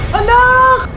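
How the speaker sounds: grief-stricken shout. Acted. Mother finds her child dead.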